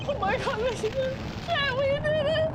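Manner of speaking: triumphant. Real, not acted. Streamer announcing the win to his chat, rising to a triumphant shout. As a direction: build from gasp to victory cry.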